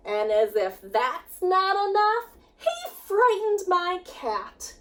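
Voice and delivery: lively, sing-song storybook reading; rhyming picture book read with energy; reading to children. A narrator's reading. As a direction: read it with bounce for the kids. Teacher reads picture book aloud.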